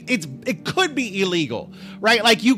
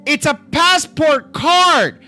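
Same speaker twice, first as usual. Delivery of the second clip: higher, louder, slow exasperated repeat; mock-patient, raised and drawn out; exasperated insistence; 6 semitones higher, 5 dB louder, slower than usual. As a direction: spell it out, fed up now. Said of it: "And I'm like".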